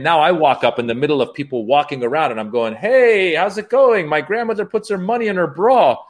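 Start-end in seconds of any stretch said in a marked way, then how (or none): 2.7-6.0 high, chipper, a little desperate; nervous comic opener pitched up; forced cheerfulness. Retold or imitated. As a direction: fake pep for a room that isn't listening. Forced opener to a restless crowd.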